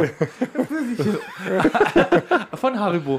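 high squeaky laughing voice; can't stop giggling. Real, not acted. Laughing repeat of Pfirsiche.

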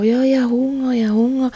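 a hint of a child begging for food; whiny toddler. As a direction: mimic the hungry little girl nagging. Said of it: "Dann die Grosse mittlerweile auch".

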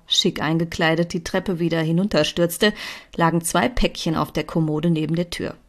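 plain reading voice

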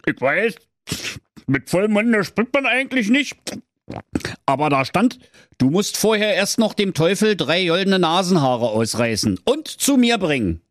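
high, sneering king voice, snatching the paper; mocking king. King taunts Schnitzelschorsch, high sneering voice.

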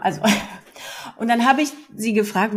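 normal talking voice

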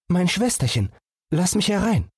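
small animal voice, longing to run out; eager little fawn. Acted. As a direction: beg like a restless young deer. Fawn at the door.

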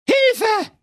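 shrill, high terrified cry for help; panic scream. Acted. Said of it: "schrie in heller Angst". (panischer Hilfeschrei)

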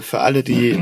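normal talking voice

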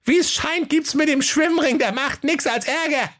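put-on very high goat-kid voice, matter-of-fact; little goats. A narrator's reading. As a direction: speak like sensible little goats. (hohe Geißleinstimme, vernünftig)

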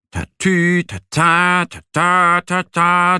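hohes, lautes Tatü-tata; Sirene. Acted. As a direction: mach die Feuerwehrsirene nach. Feuerwehrsirene nachgemacht. (nachgeahmtes Martinshorn)

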